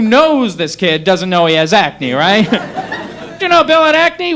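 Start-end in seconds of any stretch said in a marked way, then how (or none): 3.4-4.3 much higher put-on gossipy question; curious acquaintance. Acquaintance asking about the kid in the acne commercial, mock-curious. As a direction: play a mock-astonished acquaintance.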